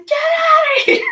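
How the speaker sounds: high, loud squeal of disbelief; retold thrilled disbelief.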